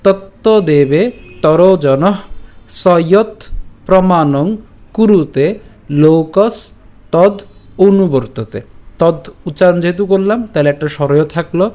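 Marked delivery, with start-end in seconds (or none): 0.0-8.6 slow, drawn-out Sanskrit, phrase by phrase; careful recitation demo. Shown as a demonstration. Teacher repeats from the beginning, slow careful recitation. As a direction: stretch the long vowels and pause between words. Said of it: "আমি আবার বলছি প্রথম থেকে".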